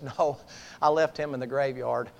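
low, softened assurance; quiet sincere answer. Acted. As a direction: tell your wife gently and solemnly. Changed man answers his wife.

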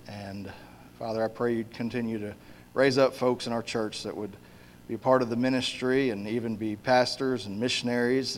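soft, earnest prayer voice. Acted. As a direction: pray quietly and sincerely. Pastor leads a prayer.

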